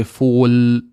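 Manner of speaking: FOOL drawn out on a clear held l; fully pronounced l. Shown as a demonstration. Teacher showing what British speakers don't say, held l. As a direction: hold the l of FOOL, tongue up. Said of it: "We don't say".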